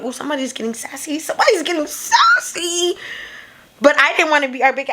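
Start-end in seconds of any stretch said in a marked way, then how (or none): 0.0-1.2 quick, quiet sassy aside; understated sassy quip; sassy remark. Retold or imitated. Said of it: "I was like". 1.2-2.9 hammed-up sassy singsong; exaggerated sass. Repeats her sassy reaction, exaggerated.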